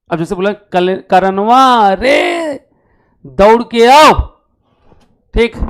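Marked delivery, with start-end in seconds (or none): 1.1-2.6 calling out. Shown as a demonstration. Teacher acting a parent calling Karan home. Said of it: "जोर से चिल्ला रहे हैं". 3.2-4.1 loud call to come running. Shown as a demonstration. Teacher acting a parent yelling for Karan to run home. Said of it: "जोर से चिल्ला रहे हैं".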